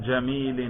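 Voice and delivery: جمیل pronounced "jamilin"; genitive -in ending. Shown as a demonstration. Teacher modelling the genitive for a student, final -in sounded. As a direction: finish جمیل with an audible -in. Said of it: "جر پہ تلفظ کیجئے".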